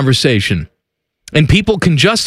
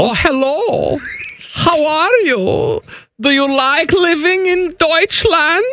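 high-pitched, drawn-out mock German accent; high comic German greeting; Common Man's German accent; 13 semitones higher than usual. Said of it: "Common Man's German accent".

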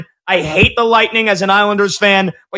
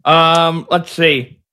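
talking around a pen; pen in mouth; slower than usual. Real, not acted. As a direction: mumble with a pen between teeth. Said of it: "I got my pen in my mouth".

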